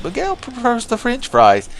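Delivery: high put-on character voice; Miguel. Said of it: "He's like".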